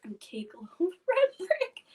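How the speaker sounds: whispered through laughter; laughing whisper. Real, not acted. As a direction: giggle it out breathily. Laughing repeating his phrase.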